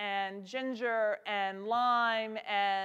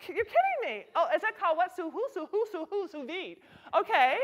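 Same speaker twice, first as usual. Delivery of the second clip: high, gushing imitation of a fine diner; hammed-up foodie amazement, much higher; mock fancy foodie; 6 semitones higher than usual. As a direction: send up the wide-eyed diner gasping at sous vide. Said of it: "have all of these".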